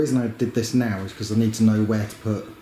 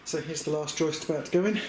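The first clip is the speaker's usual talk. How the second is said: out of breath; 6 semitones higher, 4 dB quieter than usual. Real, not acted. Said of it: "sorry, I'm a bit out of breath".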